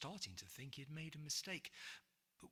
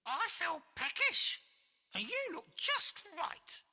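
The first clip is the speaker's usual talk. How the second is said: high, loud cackling witch voice; shrill witch calling the boy over; sinister witch; 10 dB louder than usual. Acted. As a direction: beckon like a grinning witch. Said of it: "said the witch with a sinister grin".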